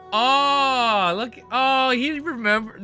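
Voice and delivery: normal talking voice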